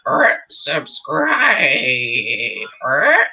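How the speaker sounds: squawky, drawn-out parrot. Acted. Parrot trained to plug the channel, squawking parrot voice. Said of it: "get the parrot to say".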